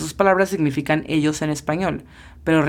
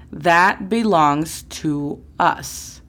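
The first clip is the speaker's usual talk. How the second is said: That belongs to us said slowly and evenly; slow model sentence; much slower than usual. Shown as a demonstration. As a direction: lay each word out for learners. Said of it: "reclamar propiedad".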